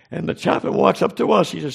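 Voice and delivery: usual speaking voice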